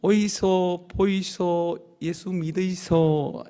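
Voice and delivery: very slow Busan-dialect call, 믿으이소 falling; Busan market call. Shown as a demonstration. Pastor leading the congregation's first try, slow Busan call. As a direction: model the call at an easy level. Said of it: "한번 해봅시다".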